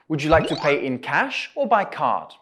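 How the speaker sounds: in cash rising, by card falling; rise then fall. Shown as a demonstration. Teacher modelling a choice question, rising then falling. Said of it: "go up at in cash and down at by card".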